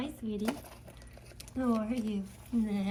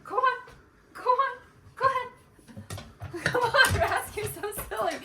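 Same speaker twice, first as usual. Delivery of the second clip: excited pet talk. Real, not acted. Baby-talks to dog going upstairs.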